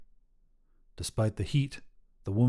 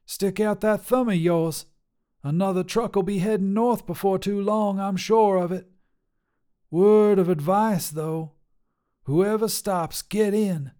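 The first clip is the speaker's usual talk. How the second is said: higher, bold trucker woman voice; tough lady trucker; 8 semitones higher than usual. Acted. Trucker Sharon sizing up a hitchhiker, brash and higher. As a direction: call out like a brash long-haul trucker.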